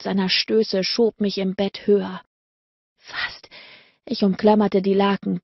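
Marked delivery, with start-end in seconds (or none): 3.0-3.5 an unvoiced, breathy whisper; breathless whisper. A narrator's reading. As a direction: breathe the word out, no voice. Close to climax, begging.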